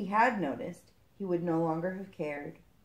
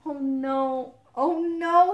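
high, dismayed moan, growing louder; wailed dismay, pitched up; 7 semitones higher, 7 dB louder, much slower than usual. Acted. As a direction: moan it in distress. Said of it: "he moaned".

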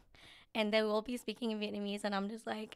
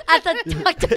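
higher, louder laughing words; 7 semitones higher, 15 dB louder, slower than usual. Real, not acted. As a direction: laugh it out slowly. Laughing reply.

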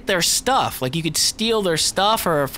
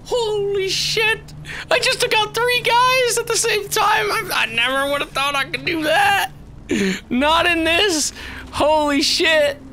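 astonished; 9 semitones higher than usual. Real, not acted. Gamer amazed at taking out three guys, high astonishment. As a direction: exclaim high, stunned by your own feat.

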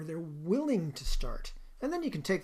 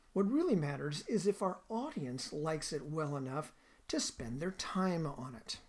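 usual speaking voice

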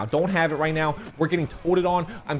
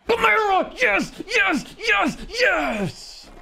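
ecstatic shouting; much slower than usual. Real, not acted. Gamer celebrating a touchdown to his viewers, ecstatic shouting. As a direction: scream it like your team just scored.

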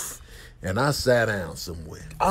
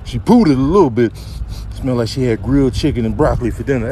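deadpan investigator character; 9 dB louder, faster than usual. Acted. Buddy telling Chris she shows no heartbeat, deadpan. Voices a character sizing up collapse.

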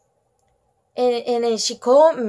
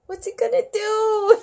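laughing; 8 semitones higher than usual. Real, not acted. Laughing reaction to the story.

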